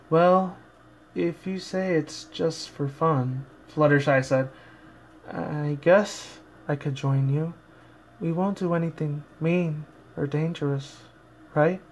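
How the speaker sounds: soft, slow, timid pony voice; shy timid Fluttershy. Acted. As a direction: barely dare to speak, shrinking away. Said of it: "Fluttershy tried to bring her shyness and timidness".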